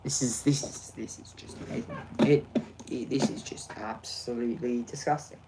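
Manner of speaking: soft, whispered, sarcastic; muttered sarcasm. Real, not acted. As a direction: grumble it under breath.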